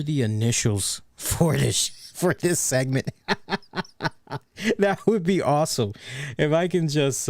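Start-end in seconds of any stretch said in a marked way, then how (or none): 3.2-4.1 soft, high chuckle in short bursts; quiet staccato giggle; amused laugh. Real, not acted. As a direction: laugh lightly at your own slip. Laughs at forgetting the segment's initials.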